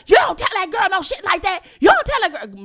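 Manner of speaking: high indignant mama protesting; high protesting mother voice; mama objecting. Retold or imitated.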